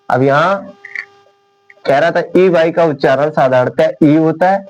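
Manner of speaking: usual speaking voice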